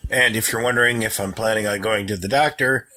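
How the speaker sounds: usual speaking voice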